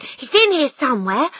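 high, loud, excited cry. A narrator's reading. Matilda hunts the intruder, urgent cry. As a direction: call it out urgently. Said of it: "Matilda cried".

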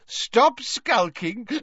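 put-on high, merry drunken summons; Slughorn, hiccuping happily. A narrator's reading. Tipsy host calls a colleague to join them, hiccuping and happy. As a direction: call out tipsily, drawing out the first words. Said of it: "hiccuped Slughorn happily".

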